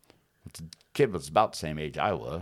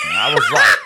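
much higher and louder, near yelling; excited shout; 14 dB louder than usual. Real, not acted. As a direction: yell it out, carried away. Shouting while retelling punch.

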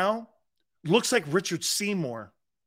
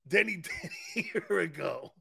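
breaks into laughter mid-line; laughing; 7 dB quieter than usual. Real, not acted. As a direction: lose it and laugh through the words. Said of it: "I gotta say it without laughing".

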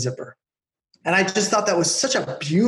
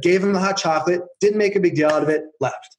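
ordinary talk, nothing marked

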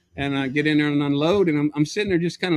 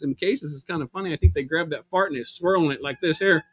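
ordinary talk, nothing marked

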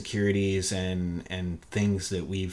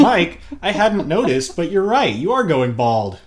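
much higher and louder, a hammed-up fake sympathy; mocking partner voice; 9 semitones higher, 9 dB louder than usual. Imitating a partner telling Mike he is going bald, high and loud mock sympathy. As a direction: ham up the partner bluntly agreeing. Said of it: "A very unflattering way".

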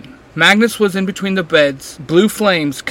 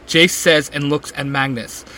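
plain reading voice

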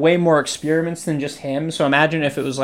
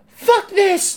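much higher mock kid outburst; pretend tantrum. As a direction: fake a kid's furious tantrum. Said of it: "I could have been like".

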